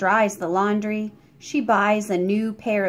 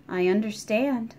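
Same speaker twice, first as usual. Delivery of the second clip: ordinary delivery, nothing marked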